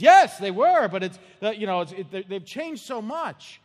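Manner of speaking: high, loud mock wife, slowing at the end; wife yelling. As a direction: shout back agreeably. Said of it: "she says".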